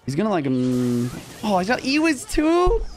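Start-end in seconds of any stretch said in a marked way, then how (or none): 1.4-2.8 high, drawn-out whine of disbelief; incredulous gamer whining; exasperated complaint. Real, not acted. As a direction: protest the unfair matchup, voice jumping way up. Bad matchup appears in game.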